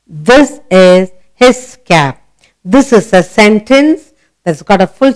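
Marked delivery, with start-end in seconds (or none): 0.1-2.2 slow, word by word; slow reading aloud. Shown as a demonstration. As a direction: read it slowly for a child. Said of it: "So what does he say?".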